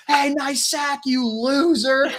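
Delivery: high mock yell, stretched at the end; imitated heckling scream. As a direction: mimic a taunting fan, drawing out the insult. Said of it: "you're screaming at the guy".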